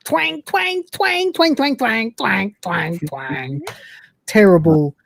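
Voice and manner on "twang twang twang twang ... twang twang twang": high twangs sliding steadily down and fading; imitated guitar twanging. Showing how terribly Lister plays guitar, high imitated twangs. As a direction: mimic a badly played guitar, starting very high. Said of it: "you hear Lister guys like".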